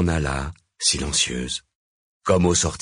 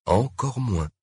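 ordinary delivery

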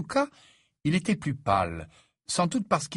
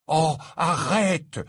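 drawn-out, louder, higher plea; pleading; 6 semitones higher, 5 dB louder, much slower than usual. Acted. Ron begs Hermione to stop, slow loud plea. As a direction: beg her to stop, stretching it out. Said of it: "la supplia Ron".

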